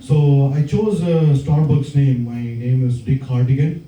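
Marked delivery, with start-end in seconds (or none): none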